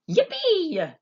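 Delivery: high, loud yippee; an old man's burst of joy; thrilled cheer; gleeful whoop. A narrator's reading. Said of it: "said Grandpa Joe".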